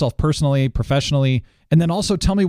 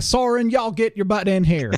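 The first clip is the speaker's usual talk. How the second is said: slow, high Texas drawl, mock scolding; Texas accent; 9 semitones higher than usual. Host imitating the guest's wife calling him in, Texas drawl. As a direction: ham up a Texan wife hollering "y'all". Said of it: "I love doing accents once in a while".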